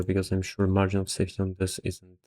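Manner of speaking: ordinary talk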